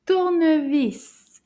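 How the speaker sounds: Tournevis a little tighter, still in clear syllables. Shown as a demonstration. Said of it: "A little faster".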